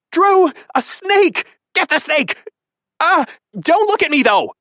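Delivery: naked woman cornered by a snake screeching; panicked screech. A narrator's reading. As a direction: shriek at the snake and at him at once. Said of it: "screeching at the top of her lungs".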